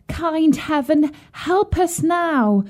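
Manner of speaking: put-on slow, high, pleading girl; crying out. A narrator's reading. Sobbing Gretel begs heaven for help, high pleading cry. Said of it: "she cried".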